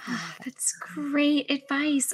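soft, slow whispered admiration; breathy whisper of awe. Real, not acted. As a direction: sigh it out, moved. Host reacts to the advice.

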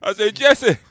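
Jesse Jackson impression. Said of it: "That was Jesse".

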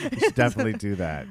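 words squeezed out laughing. Real, not acted. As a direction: laugh through the line. Cracking up.

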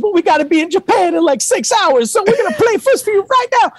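laughing mock stage banter to an empty arena. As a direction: work the arena like a big stage host. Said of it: "a [name] banter".

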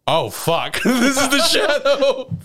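high, loud, laughing mock panic; alarmed realization. Character realizing Mio is the shadow, alarmed.

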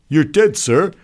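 high, loud soldier's voice; enlisted man reporting. Acted. Enlisted man informing the doctor, high and loud. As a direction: pipe up loudly to an officer.

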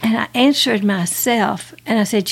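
usual speaking voice

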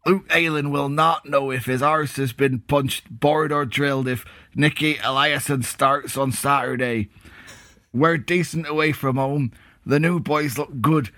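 forum post in a put-on West Country accent. Co-host reads Bristol fan forum post, West Country accent. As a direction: read it out in a mock West Country burr. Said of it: "Where's your West Country accent?".

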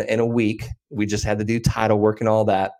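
normal talking voice

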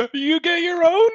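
very high, loud put-on squawk; mock turf defence. Joking as an expat guarding his Canadians, mock outrage. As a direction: playfully warn a rival off in a high voice. Playing a territorial Irish expat.

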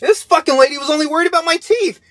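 high-pitched exasperated ranting; mocking exasperation. Real, not acted. Son complains about mom's fixation on teeth, exasperated. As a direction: pitch it way up in mock disbelief.

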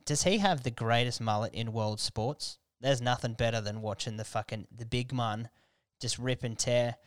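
ordinary talk

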